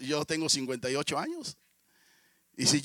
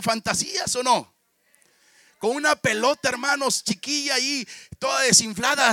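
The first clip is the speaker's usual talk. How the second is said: loud, higher preaching with music behind; full-force preaching; 6 semitones higher, 8 dB louder than usual. Acted. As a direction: preach it out to the congregation. Predica a pleno pulmón. (predicación a todo pulmón, más alta y fuerte)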